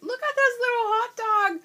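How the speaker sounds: high-pitched gushing; delighted squeal. Real, not acted. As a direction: squeal over something adorable. Gushing over a cute sticker.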